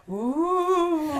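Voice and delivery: slow, high ghostly ooh. Shown as a demonstration. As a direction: moan it like a ghost. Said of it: "Moan like a ghost?".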